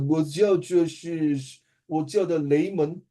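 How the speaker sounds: put-on slow, halting Mandarin in a foreign accent; 老外口音的慢速华语; foreigner's Mandarin. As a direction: sound like a foreigner struggling in Mandarin. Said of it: "好像老外讲，讲华语".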